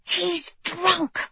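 high, tight indignant hiss. A narrator's reading. Bride complaining to the king about her groom, hissed. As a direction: hiss it in outrage. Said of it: "she'd hissed".

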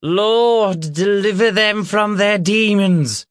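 high, loud, drawn-out preacher's cry; reverend calling out. Acted. Reverend praying over the children's demons, calling out. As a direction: call it out like a fervent reverend. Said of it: "the Reverend called".

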